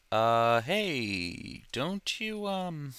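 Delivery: high, hesitant questioner; imagined viewer asking. Acted. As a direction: voice a viewer piping up with a doubt. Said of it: "I can already hear people asking me".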